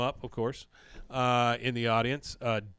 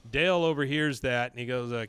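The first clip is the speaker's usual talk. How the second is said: usual speaking voice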